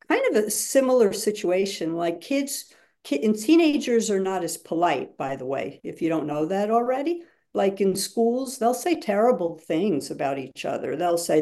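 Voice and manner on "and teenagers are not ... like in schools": ordinary delivery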